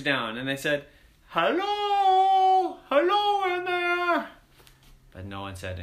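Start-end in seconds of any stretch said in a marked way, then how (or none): 1.3-4.3 high, loud, drawn-out hail; calling out. Acted. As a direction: holler across to someone inside. Calling into the castle.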